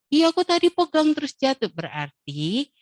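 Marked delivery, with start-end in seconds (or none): 0.1-1.7 put-on higher child's voice admitting it; small child's reply, higher and a touch louder; child explaining.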